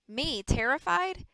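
incredulous echo of the accusation; mock disbelief. A narrator's reading. Rick scoffing at Maddie, incredulous. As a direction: pitch leaping up in a question.

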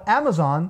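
"Amazon" with short vowels, starting as in cat; correct model. Shown as a demonstration. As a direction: say "Amazon" as AE-muh-zon. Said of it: "se pronuncia Amazon". ("Amazon" com vogais curtas)

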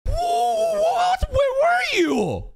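sudden high, loud shout of shock. Real, not acted. Viewer jumps at a girl appearing out of nowhere, shocked shout. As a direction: yell it, caught totally off guard.

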